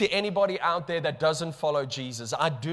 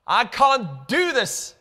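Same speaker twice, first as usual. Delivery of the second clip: pleading cry; 6 semitones higher, 5 dB louder, slower than usual. Acted. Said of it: "we say".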